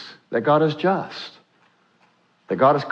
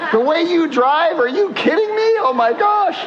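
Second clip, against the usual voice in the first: high-pitched, laughing, mock-outraged complaint; exasperated guardian angel; 13 semitones higher than usual. Guardian angel scolding a reckless driver, mock exasperation. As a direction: play an incredulous angel, voice shooting way up. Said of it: "gonna see my guardian angel".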